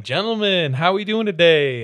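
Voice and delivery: hearty "hello, folks" server voice; retold cheery greeting. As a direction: re-enact greeting a table with gusto. Retold greeting to restaurant table.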